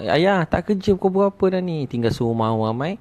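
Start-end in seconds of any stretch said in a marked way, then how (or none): 0.1-1.9 put-on high-pitched child fretting at a parent; acted panic. Child asking father why he isn't off to work, mock panic. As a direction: imitate an alarmed child, voice pitched up. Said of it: "Satu keluarga panik".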